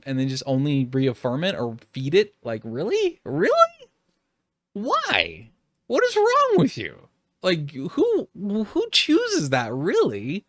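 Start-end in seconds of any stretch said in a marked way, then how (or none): 2.4-10.4 cracking up into an incredulous squeak; exasperated disbelief. Real, not acted. Exasperated outburst at followers.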